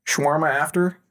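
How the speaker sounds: casual cocky Tony Stark calling the team; Robert Downey Junior impression. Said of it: "little bit of R D J in here".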